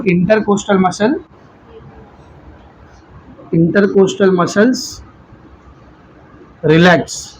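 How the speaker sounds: slow, quiet-paced note giving; measured terms with long gaps for writing; slow dictation. Real, not acted. Teacher dictates the muscle step to students, slow dictation.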